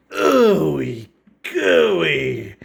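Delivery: disgusted. Retold or imitated. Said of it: "He's disgusted".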